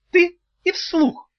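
high, slow, cutting; curt witch. Acted. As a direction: snap it like a witch. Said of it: "отрезала ведьма".

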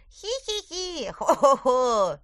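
high giggle then loud ho-ho; hee-hee then booming ho-ho-ho; hammed-up laughing. A narrator's reading. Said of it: "смеялись они".

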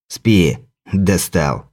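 low irritated leprechaun voice; grumble, fed up, low. Acted. Leprechaun annoyed at narrator.

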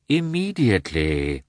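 slow, unhurried reply; lightly voiced calm doctor. A narrator's reading. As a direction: answer without any hurry. Doctor answers calmly.

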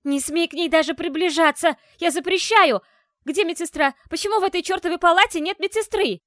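high, loud, fast furious shout; screaming. A narrator's reading. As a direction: yell at him in a rage. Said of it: "Алиса сорвалась на крик".

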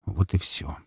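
whispered, very soft; said quietly. A narrator's reading. Zhelezny repeating his farewell to Shava, whispered. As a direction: let it out as a near-soundless whisper. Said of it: "тихо произнёс Железный".

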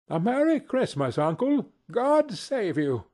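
bright-spirited, high-pitched greeting; cheerful cry. A narrator's reading. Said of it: "cried a cheerful voice".

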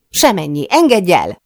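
sharp, loud demand rising on the release. A narrator's reading. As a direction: firmly order him to let go. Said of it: "szólok rá erélyesebben". (erélyes, hangos felszólítás)

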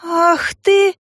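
high, wailing burst of indignation; outraged exclamation. A narrator's reading. Woman reacting to man's accusation, outraged. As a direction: cry out in outrage.

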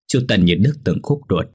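usual speaking voice

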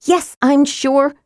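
high, slow, cross confirmation; irritated, drawn-out reply. A narrator's reading. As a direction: spell it out crossly. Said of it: "Tika sounded very cross".

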